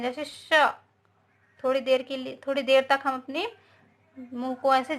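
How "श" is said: श held as a long hiss; drawn-out sh. Shown as a demonstration. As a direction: stretch the श as a long steady hiss. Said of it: "इनका उच्चारण लगातार वैसे बोलते हैं".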